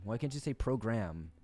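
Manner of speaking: ordinary delivery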